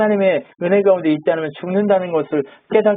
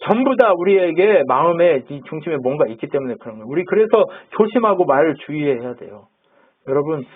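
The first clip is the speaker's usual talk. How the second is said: ordinary delivery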